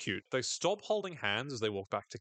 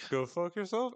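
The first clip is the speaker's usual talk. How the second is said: "Go fuck yourself?" with a higher questioning rise on "yourself"; rising question tune; 8 semitones higher than usual. Retold or imitated. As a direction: toss it off as a puzzled question. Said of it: "I love the implied question mark".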